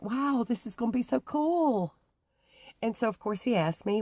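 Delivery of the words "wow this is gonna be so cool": high, bright burst of delight; excited thought. Retold or imitated. To herself on meeting a Bible reader, thrilled. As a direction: gush with excitement. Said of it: "I was like".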